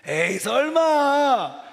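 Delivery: loud, drawn-out scoff of disbelief; slow, hammed-up "no way"; exaggerated disbelief. Shown as a demonstration. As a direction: stretch it out in a big, high dismissal. Models disbelieving reply after weak attempt.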